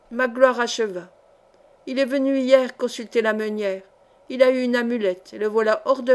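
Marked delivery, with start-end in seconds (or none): none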